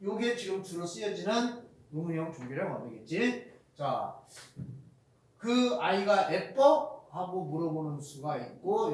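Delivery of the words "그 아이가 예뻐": slow, with 예뻐? rising at the end; rising question. Shown as a demonstration. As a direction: draw it out and lift 예뻐? up. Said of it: "이것도 뒤를 올려주면은". (느리게 "예뻐?" 끝을 올려서)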